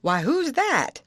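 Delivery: puzzled at the stranger; old man's surprise. Acted.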